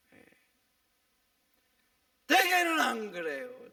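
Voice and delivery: loud, ringing sermon delivery; preaching at full force. Acted. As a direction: hammer the warning home from the pulpit. (힘차게 외치는 설교)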